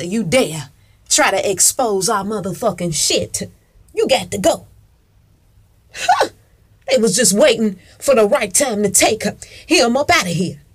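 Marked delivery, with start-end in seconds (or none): 5.9-6.3 sharp high-pitched huh; mock huh. Real, not acted. Vlogger mocking those who dare to expose, high huh. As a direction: yelp a knowing huh.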